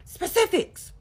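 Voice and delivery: high, loud imitated yelling; mock yell. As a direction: shout it like a man losing it. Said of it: "then he starts yelling".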